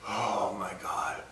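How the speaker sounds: soft, slow whisper. Real, not acted. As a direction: breathe it out weakly. Burning mouth, hushed.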